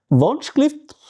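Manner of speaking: high-pitched polite call, Wunschlift; calling the waiter. Shown as a demonstration. Customer raising a finger to the waiter, high call.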